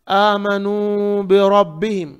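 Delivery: बिरब्बिहिम with a thick, rounded ra; heavy ra. Shown as a demonstration. Teacher models the verse for students, heavy ra. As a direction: recite आमनू long, then heavy ra in बिरब्बिहिम. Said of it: "रा को मोटा पढ़ेंगे".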